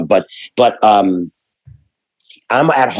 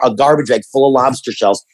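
usual speaking voice

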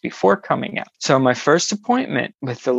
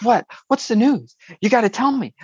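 higher, insistent short bursts; exclamatory texts; 7 semitones higher than usual. Retold or imitated. Friend texting to demand the news, exclamatory. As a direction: read out urgent texts as separate exclamations. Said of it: "all exclamation points".

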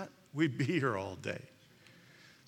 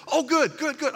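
blurted out cheerfully; 12 semitones higher, 7 dB louder than usual. Retold or imitated. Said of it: "And I said".

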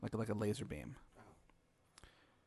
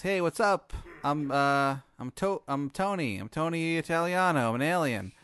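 loud, higher mock Italian-guy alien; greeting a kidnapped human, mock Italian-American alien; alien Tony; 5 semitones higher, 5 dB louder than usual. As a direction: play the friendly alien boss, louder. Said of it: "he goes".

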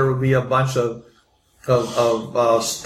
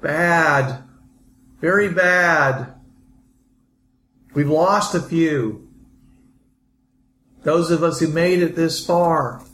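weary survivor; 4 semitones higher, slower than usual. Acted. GM voices weary NPC survivor.

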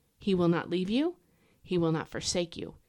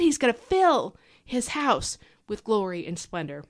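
normal talking voice

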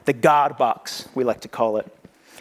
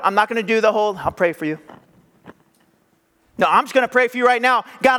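eager, insistent; 7 semitones higher than usual. Retold or imitated. David refusing to wait.

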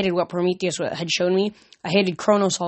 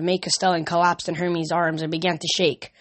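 ordinary delivery

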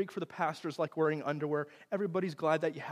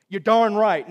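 loud, high, triumphant blurt; sudden hammed-up self-satisfaction; mock cocky reply; 6 semitones higher, 15 dB louder, slower than usual. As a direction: crow it like a smug husband. Mock reply he did not give.

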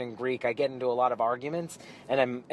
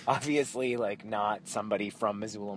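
ordinary talk

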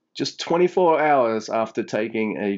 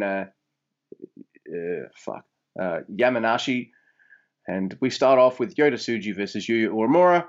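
ordinary talk

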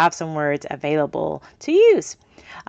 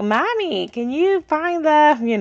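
imitating a child; 9 semitones higher, slower than usual. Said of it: "they'll kinda start quizzing you back".

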